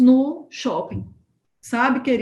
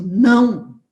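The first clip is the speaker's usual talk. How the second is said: inner no; 6 dB louder than usual. Retold or imitated. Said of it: "dentro do meu espírito".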